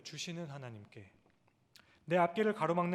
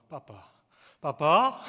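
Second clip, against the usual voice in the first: put-on toddler babble, varied pitch; 13 dB louder, much slower than usual. Father shows how his baby's babble shifts in pitch, playful. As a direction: babble like a baby, changing only the pitch. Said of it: "높낮이만 조금씩 다르죠".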